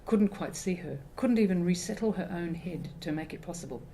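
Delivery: usual speaking voice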